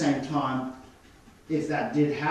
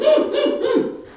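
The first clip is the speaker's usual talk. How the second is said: a sudden high squeaky yelp; imitated puppy yelp; 5 dB louder than usual. Mimicking the puppy's yelp after being nipped, high yip. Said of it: "The puppy will go".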